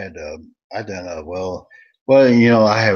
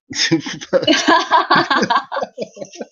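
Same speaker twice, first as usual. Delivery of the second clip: big laugh; 4 dB louder than usual. Real, not acted. Laughing at Julie's comment.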